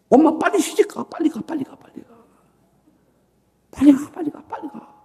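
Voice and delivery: put-on boy's pleading voice pitched high, repeating and fading; child urging mother. Preacher voicing his child self urging his mother, fading child voice. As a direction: play the eager little boy telling mom to go. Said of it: "막 이랬다고요".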